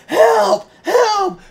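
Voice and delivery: high, loud cry for help out a window; hammed-up shrill help; mock panicked scream. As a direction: yell it up high like a panicking boss. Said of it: "screaming out to the parking lot".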